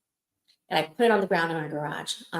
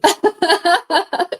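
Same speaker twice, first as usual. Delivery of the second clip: loud laugh; 11 semitones higher, 10 dB louder than usual. Real, not acted. Laughs at own slip.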